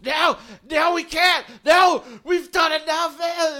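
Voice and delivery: high, loud panicked pleading; frantic, hammed-up wailing protest; crying panic. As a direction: shout it in desperate terror. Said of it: "crying and they're just".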